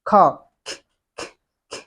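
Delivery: 'খ' as a breathy k burst; aspirated k sound. Shown as a demonstration. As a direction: demonstrate 'খ', ending in bare voiceless puffs. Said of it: "you have to sound খ". (শ্বাস ছেড়ে 'খ' ধ্বনি)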